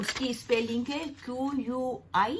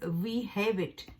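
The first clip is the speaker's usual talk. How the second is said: "We have it" read slowly; teacher finishing the example sentence slowly; much slower than usual. Shown as a demonstration. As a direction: dictate it slowly. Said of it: "अ".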